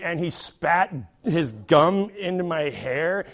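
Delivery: normal talking voice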